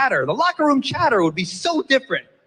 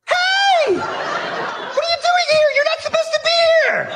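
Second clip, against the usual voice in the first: high, loud shriek of a startled woman, laughing; freaked-out woman; 4 dB louder than usual. Retold or imitated. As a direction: shriek at the man who walked in, much higher. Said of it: "she would freak out".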